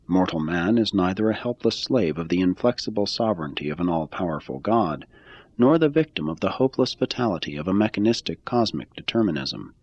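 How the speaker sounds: usual speaking voice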